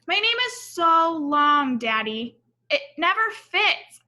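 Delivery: put-on a small girl whining that her name is too long; childlike complaint. Alma tells her daddy her name never fits, lightly whiny.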